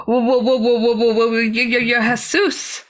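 nervous stammered whoas; stuttering. Retold or imitated. Meeting the man, stammering in awe. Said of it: "And I was stuttering".

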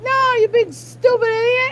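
high, loud mock insult; imitated angry outburst. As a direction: mimic a friend's sudden outburst. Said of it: "then you're like".

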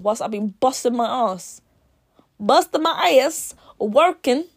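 mock fuming on her behalf. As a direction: vent like she's been cheated. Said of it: "I'm fuming for her".

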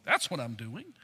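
low, quiet put-on divine retort; God. God answers Job's challenge, low and final. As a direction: drop down low for a curt imagined comeback. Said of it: "and going".

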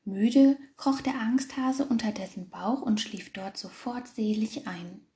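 ordinary delivery

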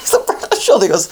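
normal talking voice

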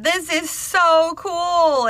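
high, long-stretched gush; fangirling. Retold or imitated. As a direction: draw it out like a thrilled fan. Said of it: "I was all hashtag fangirling".

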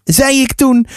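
usual speaking voice

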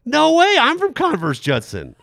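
very high, loud mock shriek; thrilled classmate. Classmate discovering they share a high school, thrilled high shout. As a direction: shout it like an overexcited girl.

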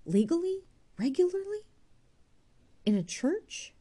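each word a fresh shock; shocked disbelief. Acted. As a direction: Jude stunned by Arabella's bigamy, incredulous. Jude shocked by her marriage.

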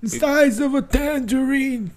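slow, high-pitched put-on voice; high mock imitation. Host parodying the film line, high drawn-out voice. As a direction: stretch it out high and hammy.

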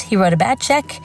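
normal talking voice

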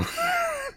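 squeaky high-pitched giggle; a helpless little giggle. Real, not acted. Reading aloud, giggling at his own joke. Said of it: "don't know why I'm laughing".

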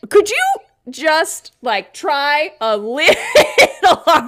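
loud, high, strained plea; exasperated rage. Real, not acted. Co-host addressing unfeeling men, exasperated and loud. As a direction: plead in outrage, stretching the last words. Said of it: "I think my rage is coming from".

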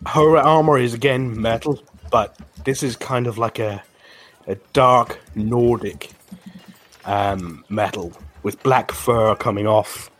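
ordinary talk

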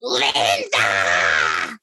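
mock scream, drawn out; imitating a woman's long holler; retold scream. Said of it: "you hear her scream".

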